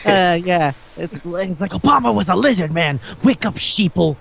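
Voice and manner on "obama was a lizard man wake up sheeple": hammed-up ranting conspiracy crank; conspiracy theorist. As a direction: preach it like a paranoid crank. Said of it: "conspiracy crazy person thing".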